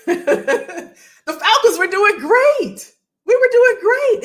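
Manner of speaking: host recalling a Falcons winning season, excited. Real, not acted. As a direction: burst out about the Falcons. Excited football memory.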